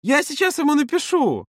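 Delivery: very high-pitched eagerness; excited announcement. A narrator's reading. Маша excitedly takes charge. (азартно, очень высоким голосом)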